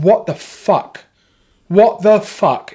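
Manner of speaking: drawn-out horrified swearing; shocked disbelief. Retold or imitated. Seeing Grenfell burn on his telly, stunned and slow.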